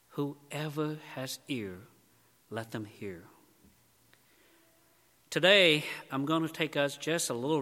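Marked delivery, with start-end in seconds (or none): 0.1-3.3 much softer and slow, weighing each word; quiet, slow, solemn. Acted. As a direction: hush it down and let it land. Jesus addressing his audience.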